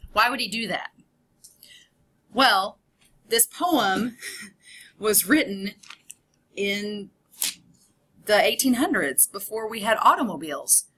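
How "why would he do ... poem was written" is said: normal talking voice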